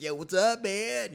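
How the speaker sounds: hammed-up hippie dude saying hi; old hippie. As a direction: greet them like a stoner hippie teacher. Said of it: "this kinda old school hippie".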